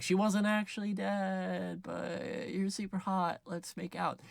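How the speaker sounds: high, soft, drawn-out wheedle; mock-sweet flirty confession; put-on Lovett voice. Lovett coming on to Sweeney, high and soft.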